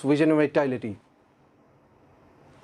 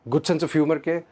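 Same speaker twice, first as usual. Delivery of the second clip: ordinary talk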